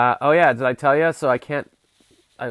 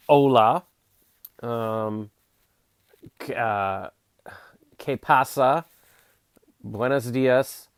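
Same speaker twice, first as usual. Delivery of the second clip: a try at Spanish; 6 dB louder, much slower than usual. Pretend. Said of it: "that's basically all my Spanish".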